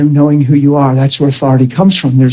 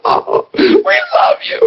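high, slow, tearful gush; swooning praise voice; mock crying worshipper; much slower than usual. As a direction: play a weeping worshipper. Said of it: "and they're crying".

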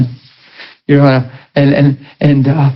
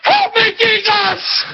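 high, fretful cries; hammed-up panic; mock church panic; slower than usual. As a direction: play the praying crowd.

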